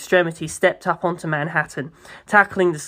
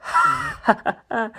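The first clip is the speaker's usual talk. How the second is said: high-pitched giggle. Acted. Character laughs, very high.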